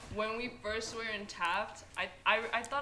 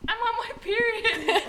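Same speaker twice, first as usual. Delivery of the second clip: higher, slow, laughing put-on voice; mocking a friend's panicked announcement, giggling; imitating a friend's blurt; 13 semitones higher, 7 dB louder than usual. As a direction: drag it out like a silly confession. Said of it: "And then Ali just".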